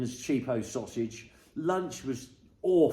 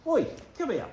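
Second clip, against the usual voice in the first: high call to the dog, a bit louder; 12 semitones higher, 3 dB louder than usual. Real, not acted. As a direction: call the dog over sharply. Calling the dog over.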